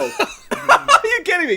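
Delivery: high laughing squeal of disbelief. Real, not acted. Friend reacting to the drink, laughing in disbelief. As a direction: laugh through the words, stunned.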